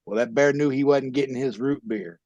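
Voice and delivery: ordinary talk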